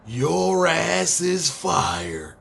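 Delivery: slow, drawn-out wrestling boss firing line; Vince McMahon. Retold or imitated. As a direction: stretch every word like a TV boss dropping the axe. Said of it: "hit him with the Vince McMahon shit".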